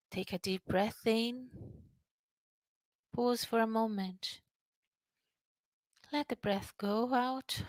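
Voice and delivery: calm breathing-exercise guide, hushed; guided breathing. Shown as a demonstration. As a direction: talk them gently through each breath.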